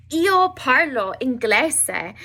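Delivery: "Io" punched out slowly and firmly; stress on Io. Shown as a demonstration. Teacher showing emphatic pronoun to learners, stress on "Io". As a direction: stretch and lean on "Io" for emphasis. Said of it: "so I'm emphasizing it".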